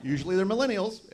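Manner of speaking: normal talking voice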